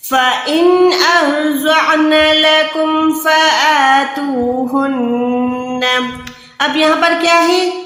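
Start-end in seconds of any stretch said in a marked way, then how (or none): 0.1-5.9 slow melodic tilawat; measured Quranic chant; Quran recitation. Acted. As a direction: chant the verse slowly.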